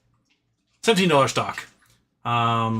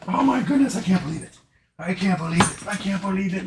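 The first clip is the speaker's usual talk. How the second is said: delighted disbelief; 5 semitones higher than usual. Real, not acted. Monkey escapes, owner delighted.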